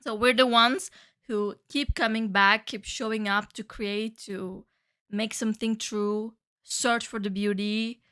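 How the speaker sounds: usual speaking voice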